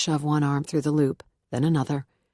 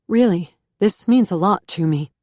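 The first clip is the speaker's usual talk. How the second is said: louder, voice starting to catch; tears welling up; 3 semitones higher, 6 dB louder than usual. A narrator's reading. Beth thanking the reverend, close to tears. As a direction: fight back sudden tears. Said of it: "the tears welling so quickly".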